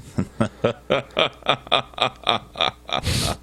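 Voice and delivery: drawn-out sinister cackle; scary villain laugh. Acted. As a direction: laugh like a horror-movie villain. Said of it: "not quite a Vincent Price scary laugh".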